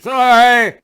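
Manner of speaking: high and loud, a slurred apology. Retold or imitated. Drunk man bumping strangers, loud high sorry. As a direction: push the apology out over the crowd. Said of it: "people couldn't even understand me".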